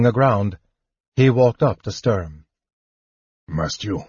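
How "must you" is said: much softer, low, near-silent question; silently. A narrator's reading. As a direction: barely voice it, low and soft. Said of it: "Flint asked Sturm silently".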